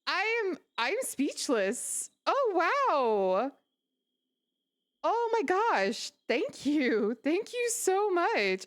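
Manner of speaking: high, breathless delighted thanks; overjoyed squeal. Real, not acted. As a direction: squeal with stunned gratitude. Gifted subs, streamer overwhelmed.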